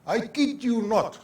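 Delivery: high-pitched kid impression, full of conviction; imitated grandchild. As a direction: imitate an earnest granddaughter insisting. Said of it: "That's how she said it".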